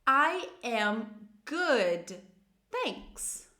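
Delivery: very slow, each word stretched and separated; slow model phrase. Shown as a demonstration. Teacher introduces first positive answer, very slowly. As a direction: spell it out word by word for learners. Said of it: "a gente vai começar com as positivas".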